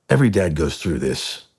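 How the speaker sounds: ordinary delivery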